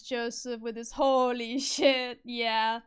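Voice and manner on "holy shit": put-on slow Japanese-accented English, stretched vowels. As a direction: imitate a Japanese speaker saying it slowly. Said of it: "their accent comes through so hard".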